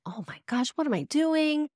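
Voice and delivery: imitating your own nervous freak-out; retold freak-out. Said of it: "I was kind of freaking out".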